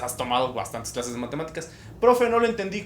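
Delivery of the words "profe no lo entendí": louder, higher-pitched student voice; imitated confused student; student pleading. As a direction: call out to the teacher, lost.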